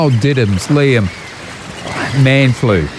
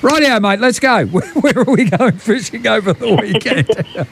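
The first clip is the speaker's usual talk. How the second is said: much higher, laughing call to get going; laughing, revved up; 11 semitones higher than usual. Real, not acted. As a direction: kick it off through laughter. Laughing launch into segment.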